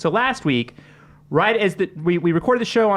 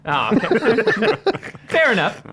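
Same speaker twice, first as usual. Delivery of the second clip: laughing; 6 semitones higher, slower than usual. Real, not acted. Host amused by guest joke.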